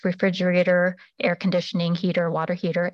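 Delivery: normal talking voice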